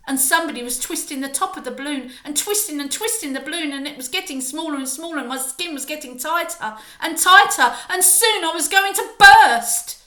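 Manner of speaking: fast, rising to a loud panicked peak; building panic. A narrator's reading. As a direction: speed up as the pressure builds. Shrinking builds to bursting.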